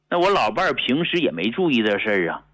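ordinary delivery

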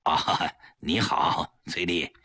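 slow, low, reluctant hello; forced-smile greeting. A narrator's reading. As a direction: greet the guest through a forced smile, dragging it out. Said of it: "强打笑脸和崔丽打招呼". (强颜欢笑地打招呼)

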